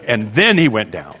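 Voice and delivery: usual speaking voice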